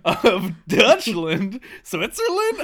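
silly royal voice that climbs as it goes; Queen Elizabeth. Pretend. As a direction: summon a servant as a comic monarch. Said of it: "it's me, Queen Elizabeth".

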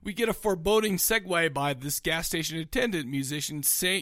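normal talking voice

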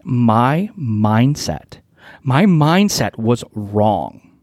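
slow, deliberate confession; owning up slowly to a wrong mindset; blunt admission. Real, not acted. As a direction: own it slowly and plainly. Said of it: "to be really blunt and transparent".

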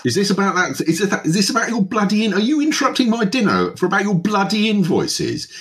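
pompous diner pitched high in fury; outraged half-elf host. Acted. Portly half-elf NPC berates visitor.

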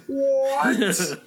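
high, drawn-out playful "what"; squealed pretend outrage with a laugh; mock shock. Mock shock at calling TTB bitches.

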